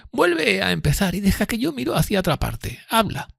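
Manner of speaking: high-pitched character voice, timid and earnest; fearful Miss Havisham, earnest. Acted. Miss Havisham confiding in Pip, high fearful voice. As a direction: voice her high and thin above the narration.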